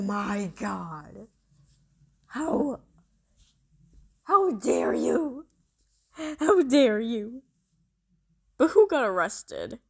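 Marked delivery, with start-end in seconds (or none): 2.3-7.4 slow, loud hammed-up outrage; mock betrayal. Betrayed by friend Sailor.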